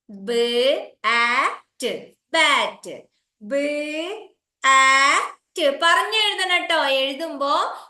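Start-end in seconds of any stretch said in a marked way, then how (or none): 0.1-5.8 slow sound blending. Shown as a demonstration. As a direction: stretch each sound, then blend the word. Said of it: "പറഞ്ഞുകൊണ്ട് എഴുതിക്കോ".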